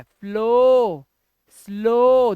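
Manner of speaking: फ्लो, स्लो with long, loud 'oh'; slow, stretched O ending on फ्लो, स्लो. Shown as a demonstration. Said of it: "ओडब्ल्यू भी ओ ही है".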